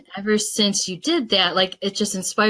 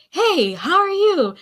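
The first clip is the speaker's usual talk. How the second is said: much higher, slow, gushing imitated hello; enthusiastic greeting; 10 semitones higher, slower than usual. Tall man welcoming her at the expo, high eager greeting. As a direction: greet her like a long-lost friend, beaming. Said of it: "he's like".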